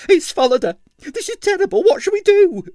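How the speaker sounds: landlady panicking in the passage; panicked landlady. A narrator's reading. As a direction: flap in a high panic.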